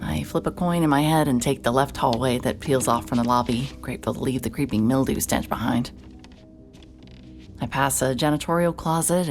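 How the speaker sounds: ordinary delivery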